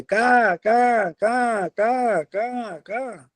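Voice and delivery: high shrill caws, loud then fading; crow cawing loudly. Acted. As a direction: caw out the signal, dying away. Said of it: "કાગડાભાઈ બોલવા માંડે". (ઊંચા અવાજે કાગડાનું કા કા, પછી ધીમું)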